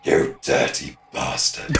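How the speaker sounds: low, slow, gleeful growl; deep mock growl. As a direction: drop the voice low and slow, teasing.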